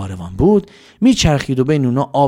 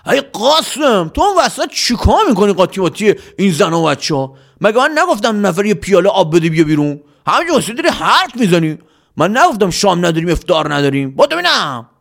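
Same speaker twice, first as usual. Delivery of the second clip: shouting; 7 semitones higher than usual. A narrator's reading. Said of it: "سلیم فریاد زد".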